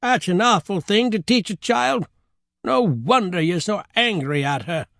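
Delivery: high, disapproving grandmother voice; clucking grandmother. Acted. Grandmother pitying the children, clucking disapproval. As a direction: cluck with high-pitched concern. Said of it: "the grandmother clucked".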